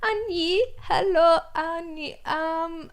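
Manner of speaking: put-on high, slow broken English accent; bad Thai accent. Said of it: "It was the worst accent".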